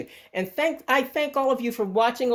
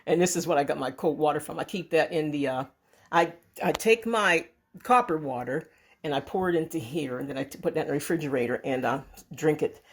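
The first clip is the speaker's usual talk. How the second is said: normal talking voice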